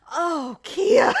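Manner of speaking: loud, high, breaking into laughter; laughing exclamation. Retold or imitated. Mom's dismay retold by laughing daughter, loud exclamation. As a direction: cry it out on the edge of laughter.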